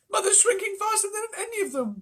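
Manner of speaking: very high, loud wail. A narrator's reading. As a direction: wail it in distress. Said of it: "wailed Missus Bucket".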